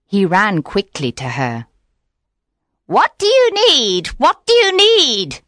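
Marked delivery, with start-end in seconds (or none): 2.9-5.4 much higher, louder eager witch voice; cackling young witch. Acted. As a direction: call out to him like a greedy witch. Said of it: "she cried".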